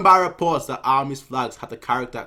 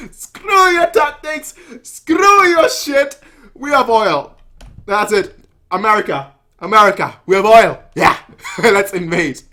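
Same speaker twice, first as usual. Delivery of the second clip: gleeful shouting; 8 semitones higher, 11 dB louder than usual. Retold or imitated. Kanki mocking the enemy's tactics, loud gleeful shout. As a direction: crow it out over the enemy's tactics. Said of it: "he's like".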